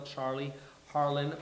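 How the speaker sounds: ordinary delivery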